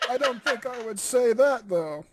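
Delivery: usual speaking voice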